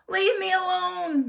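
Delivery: very high, loud shout. A narrator's reading. Teacher shouting at the kids to leave her alone. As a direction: snap at them to back off. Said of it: "Miss Jojo shouted".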